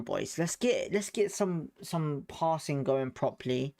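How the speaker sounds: normal talking voice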